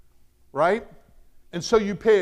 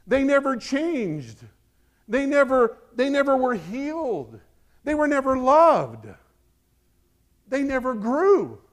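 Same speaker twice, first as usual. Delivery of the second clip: high, loud, slow preaching on never; hammering never in the pulpit; preaching crescendo; 7 semitones higher, 5 dB louder than usual. Acted. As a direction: build the sermon to a peak. Preaching at full force.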